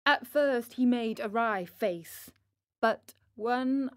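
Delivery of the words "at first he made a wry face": ordinary delivery, nothing marked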